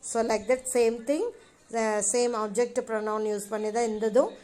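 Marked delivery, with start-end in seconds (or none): none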